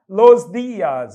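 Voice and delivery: los días slowed and loud; slow emphatic. Shown as a demonstration. Teacher stressing the article for students, slow and loud. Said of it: "A palavra dias está no plural".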